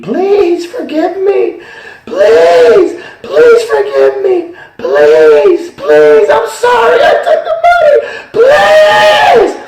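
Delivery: shrieking, high and very loud pleas for forgiveness; anguished screaming. Retold or imitated. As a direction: scream like damned souls begging. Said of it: "the people down there were screaming".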